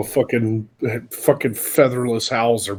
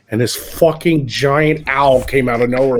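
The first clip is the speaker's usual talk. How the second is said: ordinary delivery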